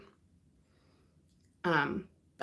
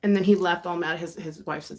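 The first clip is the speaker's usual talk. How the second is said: ordinary talk, nothing marked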